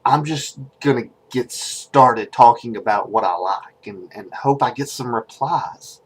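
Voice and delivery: low, quiet, halting introduction; hesitant and awkward, softly spoken with ums; nervous. Acted. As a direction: stammer shyly into a dating video. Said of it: "so I'm nervous".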